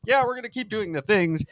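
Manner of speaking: high-pitched blithe reply; people refusing. Acted. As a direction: answer up high, cheerfully unrepentant. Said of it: "It's like".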